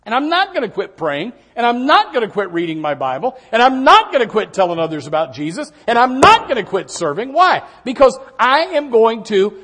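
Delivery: loud, high, driving preacher cadence; full-force preaching. Acted. Preacher rousing the congregation about Job, full-force preaching. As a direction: build it up and hammer each line.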